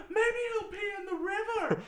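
put-on shrill excited child, way up high; high squeaky kid voice. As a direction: squeal it like a hopeful little boy. Imitating Milhouse's excited line.

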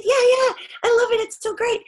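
high, bright eager insistence; eager gushing. Retold or imitated. Young extra assures Bill Murray she loves it, high eager gush. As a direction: insist like a thrilled fan.

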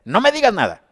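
high, loud dismissive shout; hardened listener yelling over the preacher; stubborn refusal. Retold or imitated. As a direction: slam the door on the message. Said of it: "se tapa los oídos y empieza a decir".

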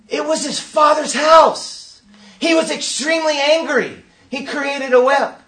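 high, forceful shout, drawn out; indignant preaching. Real, not acted. Preacher insists on Jesus' anger.

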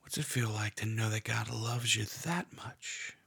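hushed guided meditation, half whispered; guided prayer meditation. Acted. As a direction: lead them gently into prayer.

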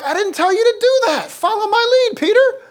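high, loud exasperated scolding; hammed-up exasperation, voice shooting up; Jesus. Master scolding an overeager follower, loud and high-pitched. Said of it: "Jesus is like".

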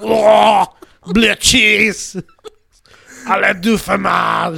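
put-on loud shouted French accent. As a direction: bellow it in a cartoon French accent. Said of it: "Like that kind of French".

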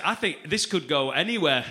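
usual speaking voice

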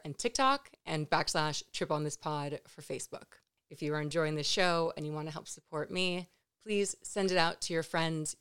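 ordinary talk

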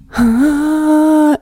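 high, held mock gasp; exaggerated gasp of reverence; mock awed gasp. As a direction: breathe in loudly, high and awestruck. Imitating the enraptured room.

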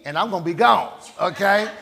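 ordinary talk, nothing marked

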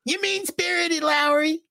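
very high mocking whine; Ralph impression. Host redoing Ralph scolding Lowry, very high. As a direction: squeak it out like Ralph. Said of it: "trying to do the Ralph accent".

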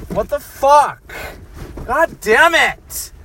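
real fury at lost sunglasses; angry outburst. Real, not acted. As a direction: snap at nobody, fed up. Furious search for sunglasses.

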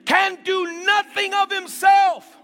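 loud, high, drawn-out scripture; preaching. Acted. Preacher proclaiming Jesus' words to the congregation. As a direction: ring it out over the music.

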